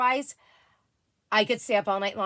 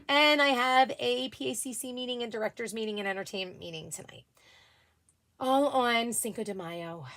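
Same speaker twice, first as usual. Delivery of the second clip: ordinary talk, nothing marked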